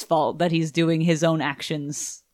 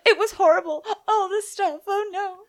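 much higher, mock-tearful wail; hammed-up sobbing Eve; 13 semitones higher than usual. Mocking how Eve delivers it, a high mock wail. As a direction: play her as over-the-top distraught. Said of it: "That is how she's delivering that".

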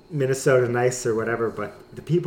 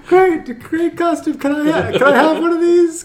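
silly high voice; 7 dB louder than usual. Pretend. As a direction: squeak it out like a cartoon neighbor. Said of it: "there's Obert's voice".

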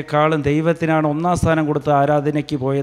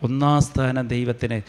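normal talking voice

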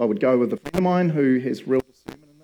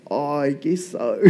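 fake calm; 4 dB quieter, slower than usual. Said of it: "externally, I was like".

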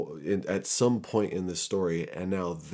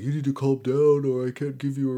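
higher, louder stern driver voice; stern Uber driver; 7 semitones higher, 6 dB louder than usual. Retold or imitated. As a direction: warn him like a fed-up driver, raised. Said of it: "the guy's like".

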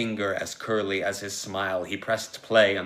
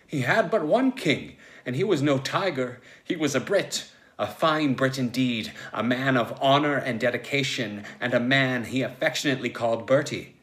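raised, higher, impassioned narration; indignant loyalty; 4 semitones higher, 4 dB louder than usual. A narrator's reading. Narrator takes up Logue's devotion to his king, impassioned. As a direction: proclaim it with rising patriotic fervour.

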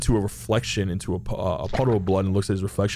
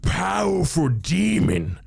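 higher, shouted grand demon speech; booming demon; 5 semitones higher than usual. Acted. Demon bunny lecturing the summoner, grand and booming. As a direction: proclaim it like a mighty demon.

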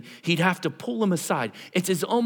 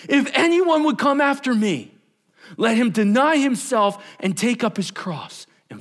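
loud, high, forceful proclamation; addressing the crowd; 7 semitones higher, 4 dB louder than usual. Acted. Jesus addresses all his followers, forceful proclamation. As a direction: declare it to everyone present. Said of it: "he turns to the crowd".

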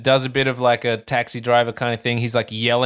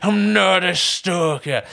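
slow, stressing NOT, pitched up; higher slow insistent denial; 5 semitones higher, slower than usual. Retold or imitated. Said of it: "he's just like".

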